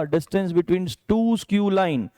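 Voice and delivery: very slowly, word by word, as if dictating; slow emphasis. Shown as a demonstration. Teacher to JEE students naming a must-do question, very slowly. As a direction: spell the topic out slowly for the class. Teacher stressing a key topic.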